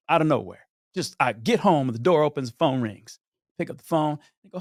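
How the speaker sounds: ordinary talk, nothing marked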